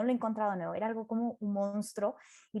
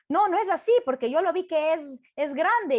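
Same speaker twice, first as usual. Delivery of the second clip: higher, louder contradicting voice; mock squabble, insisting on your own view; arguing; 4 semitones higher, 5 dB louder than usual. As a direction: play someone disputing firmly. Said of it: "discutían como".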